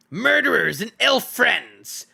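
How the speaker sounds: slow, high, loud snarling goblin cry; goblin shouting. A narrator's reading. Great goblin shouting at the captured dwarves, loud and slow. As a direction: bellow it like an enraged goblin king. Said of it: "the great goblin shouted".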